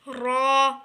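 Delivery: रॉ thick and rounded, loud; heavy letter. Shown as a demonstration. Teacher showing a rounded-tongue letter, heavy. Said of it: "इसको थोड़ा मोटा करके पढ़ना है".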